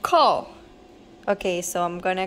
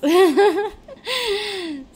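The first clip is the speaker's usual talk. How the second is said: high laugh; 10 semitones higher, 5 dB louder than usual. Real, not acted. Laughing at women's health.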